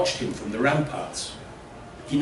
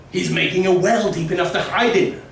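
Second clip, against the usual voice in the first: villager voice; 7 semitones higher, 6 dB louder than usual. Acted. Villagers guess at the well.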